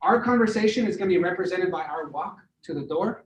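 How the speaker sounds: normal talking voice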